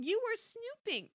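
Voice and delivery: very high, drawn-out accusation; slow, outraged high-pitched charge; accusing cry. A narrator's reading. Daughter confronts mom over diary, high accusing cry. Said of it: "Chrissy cried".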